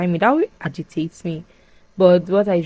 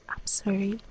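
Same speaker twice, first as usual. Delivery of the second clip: quiet apology; 2 semitones higher, 10 dB quieter than usual. Retold or imitated. Prince apologizes before betrayal.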